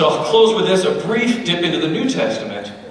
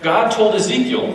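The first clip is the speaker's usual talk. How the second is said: usual speaking voice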